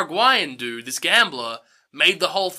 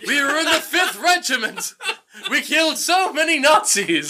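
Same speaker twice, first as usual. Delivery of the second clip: high, straining mock-dramatic voice; hammed-up old comrade; 9 semitones higher than usual. Grandpa's army friend calls to Charlie, mock-heroic cry. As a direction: declaim it like a war buddy's big speech.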